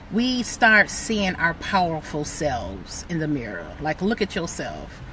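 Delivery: soft tender encouragement to viewers. Real, not acted. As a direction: speak softly to lift them up. Tender self-affirmation to viewers.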